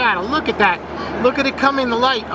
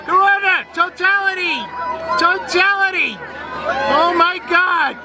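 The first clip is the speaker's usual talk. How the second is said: excited; 5 semitones higher than usual. Real, not acted. Eclipse totality reached, awed calling out.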